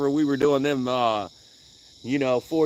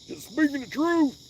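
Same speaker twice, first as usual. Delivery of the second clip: mock voice; 12 semitones higher than usual. Repeat in higher mock voice.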